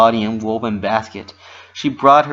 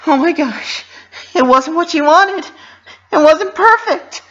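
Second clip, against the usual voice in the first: high, loud, panicked Pinkie Pie voice; distressed Pinkie; 9 dB louder than usual. Acted. Pinky realizing the party went wrong, high distressed voice. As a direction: squeal it out in dismay.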